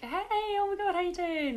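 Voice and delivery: mock gushing "oh my God"; gushing greeting. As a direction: overdo the excitement. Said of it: "So you're like".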